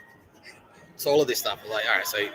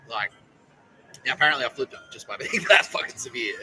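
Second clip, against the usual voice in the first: ordinary talk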